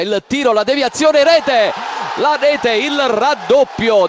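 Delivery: excited goal call. Real, not acted. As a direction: rise to the goal cry.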